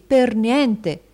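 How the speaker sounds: loud, high, triumphant; husband boasting of a steal; emphatic bargain pitch. A narrator's reading. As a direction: sell the bargain with relish.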